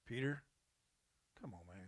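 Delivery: half awake, sighing at Peter; sleepy, soft disappointment. Retold or imitated. Jesus woken from a nap, sleepy disappointed sigh.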